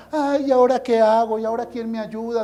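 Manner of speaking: mock whining in a monotone; immature whiner. Immature believer complaining to the pastor, monotone mock whine. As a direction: drone the complaints on one unvarying note. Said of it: "una persona inmadura, emocional e impulsiva".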